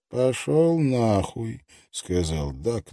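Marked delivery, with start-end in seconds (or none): 0.1-1.6 without much malice. Acted. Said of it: "но без особой злобы".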